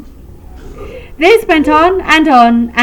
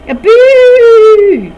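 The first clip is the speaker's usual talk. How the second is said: a big high shout of boo, like a ghost; loud ghostly boo; 11 semitones higher, 10 dB louder than usual. Acted. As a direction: jump out and yell it to startle. Family jumps out to scare.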